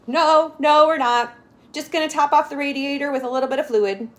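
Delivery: high, loud, hurried denial; nervous denial. Acted. Father quickly denies trouble to the policeman, nervous and loud. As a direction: cover it up with a nervous rush.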